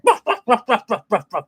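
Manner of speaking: high, laughing yappy barks; imitated dog barks. Dog barking at the laughing owner, high yappy barks. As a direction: bark like an excited little dog. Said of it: "my dog Watson, he's like".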